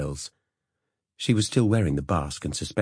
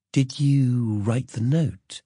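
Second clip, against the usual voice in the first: slow, sugary, higher and sweet; saccharine; 6 semitones higher, much slower than usual. Acted. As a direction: ask sweetly, hiding a trap. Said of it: "Her voice was saccharine".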